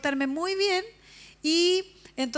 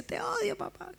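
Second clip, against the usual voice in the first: put-on child's tearful outburst; 8 semitones higher, 6 dB quieter, slower than usual.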